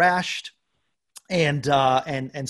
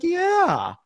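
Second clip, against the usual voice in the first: high squeaky yeah; wife's high yeah; 4 dB louder than usual. Retold or imitated. Wife answers husband with a high yeah. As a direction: squeak the yes way up. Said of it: "She's like".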